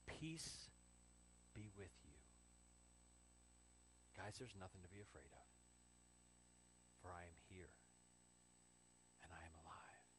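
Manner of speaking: very soft, low, calming; gentle reassurance. Acted. Jesus to scared disciples, gentle and reassuring. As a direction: hush their fears, unhurried with long pauses.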